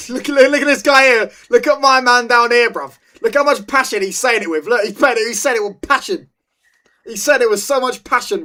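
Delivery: cracking up at the fans on screen; excited. Real, not acted. Laughing at fans on video.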